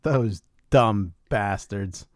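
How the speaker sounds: ordinary talk, nothing marked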